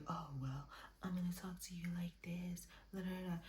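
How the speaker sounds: hushed condescending mock, nearly whispered. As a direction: imitate someone talking down, very softly. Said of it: "become condescending".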